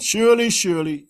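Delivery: emphatic repeated surely. Shown as a demonstration. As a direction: hit surely twice hard. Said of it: "very strong, emphatic particle".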